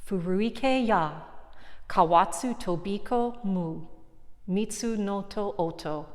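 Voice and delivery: haiku read in Japanese. Shown as a demonstration. Teacher reading Basho's haiku to students, in Japanese, unhurried. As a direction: read the Japanese haiku in one calm breath. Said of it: "I'm gonna read it first as he wrote it in Japanese".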